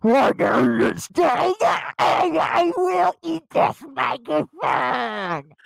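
loud distorted phone voice; speakerphone blare. Shown as a demonstration. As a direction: blast it like a speakerphone. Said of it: "blared out like this".

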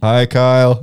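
loud, playful support-group welcome; mock group greeting. Co-host welcomes a fellow Star Wars addict, mock group greeting. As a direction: greet the newcomer like the group at a meeting. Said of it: "this is A A for Star Wars".

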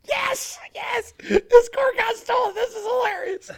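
very high, delighted laughing cheer; mock delight, high and giddy; gleeful mock cheer. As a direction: squeal it with glee. Retold glee at stolen car.

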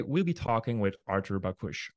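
ordinary delivery

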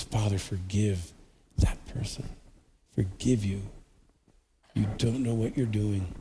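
soft, low, slowed words of mercy; gentle forgiving plea. Acted. Jesus on the cross asking forgiveness for the listener, soft and tender. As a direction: breathe the forgiveness quietly.

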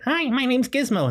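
high-pitched cartoon creature voice; tiny, high mascot voice introducing itself; Gizmo. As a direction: squeak it out like Mickey Mouse. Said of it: "That was more like Mickey Mouse than Gizmo".

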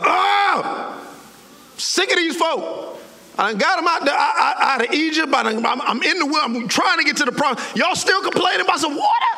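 exasperated outburst, high and climbing; hammed-up fed-up ranting; frustrated. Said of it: "he's frustrated".

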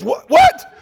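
squeaky, very high double take; Holy Ghost twitch, comic high yelp; mock startled. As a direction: jump like something bit you. Jesus twitching in mock alarm.

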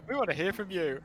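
laughing echo. Pretend. Echoing the joke.

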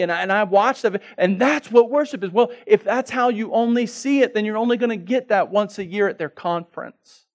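loud, high, driving sermon climax; full-force preaching. Acted. Preacher pressing the congregation, loud and high.

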